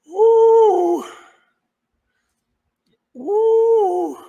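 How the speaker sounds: loud high whooping in disbelief; astonished whoop; amazed whoop. Real, not acted. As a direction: holler like you can't believe it. Commentator reacting in disbelief.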